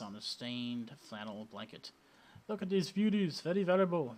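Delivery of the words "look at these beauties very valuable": hammed-up Indian accent, higher; bad Indian accent. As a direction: street vendor in a mock Indian accent, pushing his wares. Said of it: "my really bad, uh, Indian accent".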